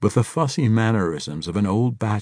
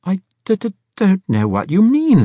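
high, loud frightened stutter; nervous stammer; 12 semitones higher, 8 dB louder than usual. A narrator's reading.

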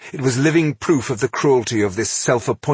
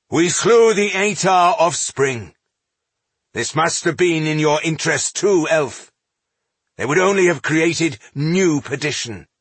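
raised, forceful justification; defiant dwarf leader; 8 semitones higher, 4 dB louder than usual. A narrator's reading. Dwarf justifies the killing to the elf, defiant. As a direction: push back hard at the accuser.

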